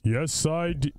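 low, put-on voice for the goldfish Jeremy; deep fish voice. Goldfish Jeremy answers the hosts' question in a deep put-on voice. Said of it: "I had no idea you had such a deep voice".

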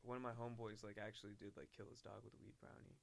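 sheepishly low and quiet, hedging as you confess; reluctant confession. Real, not acted. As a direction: own up to it very softly, trailing away. Reluctant admission, hesitating to confess.

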